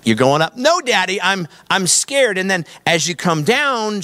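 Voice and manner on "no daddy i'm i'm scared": high, drawn-out mock plea of a frightened child; scared daughter. Daughter to dad as the coaster climbs, mock-scared. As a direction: imitate a little girl pleading, voice pitched up. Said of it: "she would say this".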